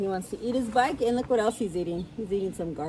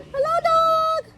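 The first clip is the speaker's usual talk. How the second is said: very high squeaky duck greeting; cheerful little cartoon duck, drawn out; duck character; 9 dB louder, slower than usual. A narrator's reading. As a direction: call out in a tiny, high-pitched duck voice. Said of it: "said Duck".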